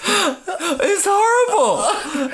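high, drawn-out wail through laughter; really crying, very high and slow. Real, not acted. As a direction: sob it out while laughing at yourself. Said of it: "funny to watch you cry".

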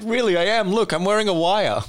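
high, loud insisting; pleading insistence. Pretend. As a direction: beg them to believe you. Pleading undercover claim.

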